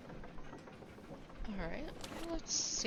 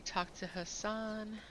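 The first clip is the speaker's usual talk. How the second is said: normal talking voice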